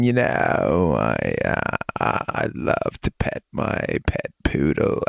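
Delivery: low, slow creaky vocal fry. Retold or imitated. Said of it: "like a Kim Kardashian kind of thing".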